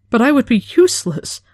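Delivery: high, loud squeak of fear; frightened squeak. A narrator's reading. Frightened apprentice blurting to her superior, high squeak. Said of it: "Her voice came out as a squeak".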